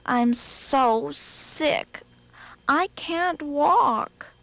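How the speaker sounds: slow high moaning sick rabbit. Acted. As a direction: moan it out like you're ill. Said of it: "He opened the door and moaned".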